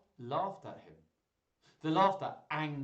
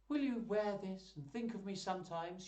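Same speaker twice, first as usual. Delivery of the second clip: higher-pitched tender request; shy young lady; 7 semitones higher than usual. Acted. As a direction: offer it hopefully, voice pitched up. Rosalind offers Orlando her chain.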